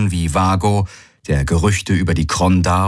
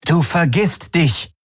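stern rebuke; 11 semitones higher than usual. Acted. Young Kronda rebukes the man.

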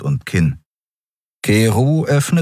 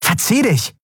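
sharp, much higher brush-off; higher, cutting get lost; angry; 12 semitones higher than usual. A narrator's reading. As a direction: send him packing. Woman drives off drug dealer.

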